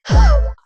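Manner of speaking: loud, high theatrical gasp; mock shocked gasp. Podcaster reacting to the reveal, loud mock gasp. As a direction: gasp big in mock amazement.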